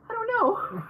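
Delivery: high-pitched mock cluelessness; imitating a friend with no idea; imitated clueless reply. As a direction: pitch it way up, playfully helpless. Retelling friend's clueless answer.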